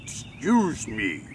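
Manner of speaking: deep, unhurried voice of a huge man; very large man. Acted. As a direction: drop the pitch and take it slowly.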